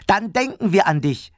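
put-on high, eager child voice; children calling out together. A narrator's reading. The children answer Papa together, high and eager. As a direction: chime in cheerfully, pitched high. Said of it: "riefen alle Kinder zusammen".

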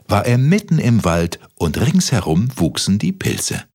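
ordinary delivery, nothing marked

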